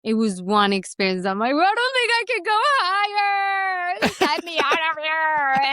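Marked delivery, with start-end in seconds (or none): 1.5-5.7 high, drawn-out mock-crying plea; panicked wail. Actor retelling her climb up a tower, panicked wail. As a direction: wail it out, dramatizing terror at the height.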